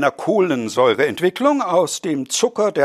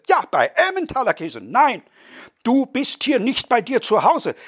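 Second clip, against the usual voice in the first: furious family row; 12 semitones higher than usual. Acted. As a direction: hurl insults, pitched high. Whole party quarrels at once.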